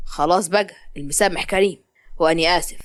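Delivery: a man recognising her, mocking; young man. Zein tells Malak he remembers her, low and mocking.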